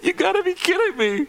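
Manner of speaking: high amused "You gotta be kidding me"; amused disbelief. Retold or imitated. God laughing at the king's boast, high amused scoff. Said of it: "God's going".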